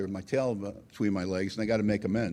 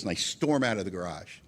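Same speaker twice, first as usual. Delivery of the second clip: ordinary talk, nothing marked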